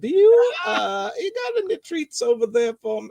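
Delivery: a nervous pug begging for treats; worried Southern pug voice. Pretend. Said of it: "this voice became something like this".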